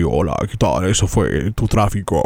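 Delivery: low booming announcer voice; fake deep radio voice. Mocking the traffic reporter's put-on voice, booming low. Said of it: "Qué voz tan fingida".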